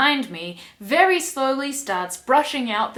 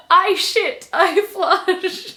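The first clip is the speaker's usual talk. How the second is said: high, loud mock declaration; comic misreading; 8 semitones higher, 6 dB louder, much slower than usual. Reader showing how they misread the book, loud comic declaration. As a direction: proclaim it loudly, pitch jumping up. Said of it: "But I read it as".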